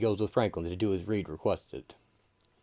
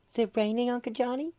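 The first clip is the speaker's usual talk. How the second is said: put-on high child voice; faster than usual. Child voice in story.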